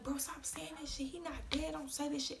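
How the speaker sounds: retold panic. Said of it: "I panicked".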